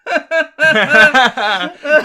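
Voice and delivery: loud burst of laughter; high, loud laugh; big laugh. Real, not acted. Laughing at his dating joke.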